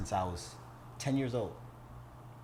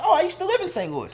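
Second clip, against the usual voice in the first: lightly voiced Nigerian man, high; quick reply in a light mock voice; imitating the uncle; 7 dB louder than usual. Nigerian owner answering him, lightly imitated voice.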